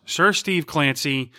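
usual speaking voice

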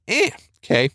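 a shrugging so-so reaction; mock lukewarm reaction to artwork; unimpressed shrug. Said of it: "And some of us will be".

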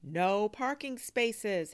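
high, loud, slow whine; dismayed complaint. Acted. Boy finding the lot full, loud dismayed complaint. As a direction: stretch out the dismay.

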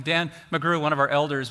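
usual speaking voice

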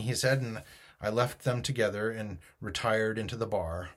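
ordinary delivery, nothing marked

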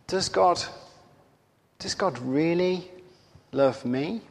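mild, hesitant self-questioning; doubting inner question. Acted. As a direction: wonder it quietly, with pauses.

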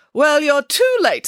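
slow, loud, high-pitched brush-off; dismissive. A narrator's reading. Nanny Piggins turns down the casting agent, dismissive. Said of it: "said Nanny Piggins dismissively".

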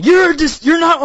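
mock villain declaration. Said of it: "with Crocodile saying".

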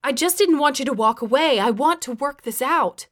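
anxious, imploring appeal; pleading. A narrator's reading. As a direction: plead to be given a chance. Character pleads to work it out.

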